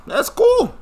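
squeaky high sarcastic that's cool; mock-cheery brush-off. Real, not acted.

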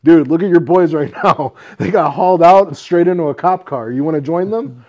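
loud, emphatic retold telling-off; retold scolding. To the brawler whose boys were arrested, loud scolding. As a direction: lay into him loudly, half amused.

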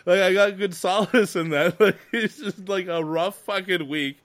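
high giggly voice; laughing. Real, not acted. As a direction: chuckle through every word. Laughing recalling solace.

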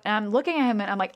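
ordinary delivery

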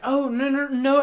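high, pleading protest; imitated dismayed girlfriend.

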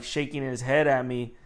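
ordinary delivery

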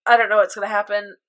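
normal talking voice

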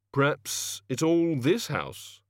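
slow, mildly miserable mutter; a hint of a sulking god. A narrator's reading. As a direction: grumble it out unhappily. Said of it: "muttered the O-God miserably".

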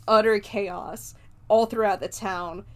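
ordinary talk, nothing marked

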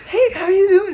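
loud, high falsetto hello; gushing mock greeting. Drunk guy greeting his crush's stepsister, gushing falsetto. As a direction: squeal it through a laugh.

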